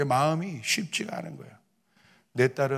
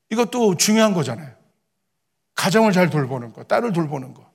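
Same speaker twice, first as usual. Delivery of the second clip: loud, higher-pitched insistent argument; emphatic self-justifying; 5 semitones higher, 7 dB louder than usual. Retold or imitated. Father justifying staying with his daughter, loudly insistent. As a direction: argue your case forcefully, as if talking yourself into it. Arguing himself out of going.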